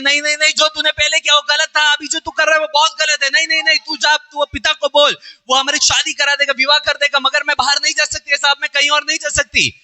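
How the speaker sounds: desperate pleading scream. Retold or imitated. Tamar begging Amnon not to cast her out, high desperate wail. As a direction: beg him not to throw you out. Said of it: "वो चीख रही है".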